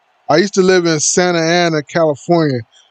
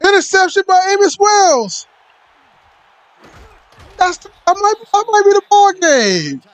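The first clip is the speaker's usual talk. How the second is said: high squeaky announcer voice; much higher put-on hype voice; character voice. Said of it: "that's just my character voice".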